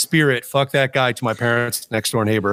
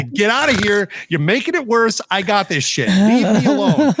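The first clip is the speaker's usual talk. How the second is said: pushing dad back, half laughing; urgent mock command; 7 semitones higher than usual. Son telling his swearing dad to back off, urgent. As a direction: hold him off, urgent and raised. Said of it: "had to stop him and turn him around and say".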